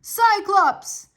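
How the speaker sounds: loud high shout across the water. A narrator's reading. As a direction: call out to the Cyclops with full force. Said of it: "Odysseus began to shout".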